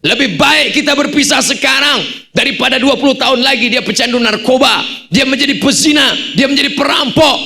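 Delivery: high, forceful husband's tirade; acted stern shout. Husband lectures his crying wife about parting from their child, shouting.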